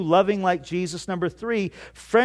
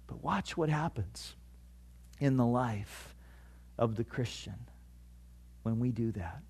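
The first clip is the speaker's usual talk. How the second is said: hushed aside; 8 semitones lower, 14 dB quieter than usual. Real, not acted. Sermon drops to a hush.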